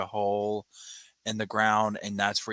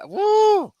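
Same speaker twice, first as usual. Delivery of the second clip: excited whoop; 9 dB louder than usual. Real, not acted. Excited cheer.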